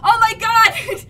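loud, high, drawn-out gasp of disbelief; stunned shout. Real, not acted. Reader reacting to the ship, stunned shout. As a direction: let the words burst out slowly. Ship suddenly appears overhead.

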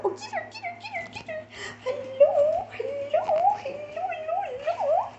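squeaky high puppy voice, cooing. Real, not acted. Owner cooing at the puppies in high baby talk. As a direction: coo at the puppies in a tiny high voice.

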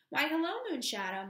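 high, loud phone hello; cheery greeting. A narrator's reading. Miss Whitlaw greeting Moonshadow on the telephone, cheery. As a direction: greet with a lively rising lilt.

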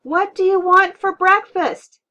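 full-out high yell, slowed and drawn out. A narrator's reading. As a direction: yell each word over the blaring TV. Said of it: "Mom yelled".

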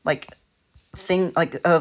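normal talking voice

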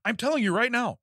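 high-pitched, emphatic protest; mock insistence. Customer at the drive-thru window, hammed-up insistence.

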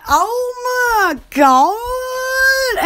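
very slow, high drawn-out exclaim; stretched-out oh my God. Retold or imitated. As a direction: stretch each word out in alarm. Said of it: "So, so I was like".

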